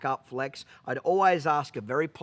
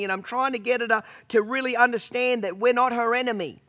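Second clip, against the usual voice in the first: whiny excuse; 6 semitones higher than usual. Retold or imitated. Whiny teen excuse to boss.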